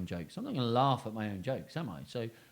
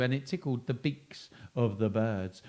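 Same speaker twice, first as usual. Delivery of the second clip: usual speaking voice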